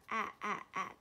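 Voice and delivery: 啊啊啊 as short, separate "a" as in cat. Shown as a demonstration. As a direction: cut each 啊 short. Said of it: "的短音哦". (啊啊啊念成短促分开的短音)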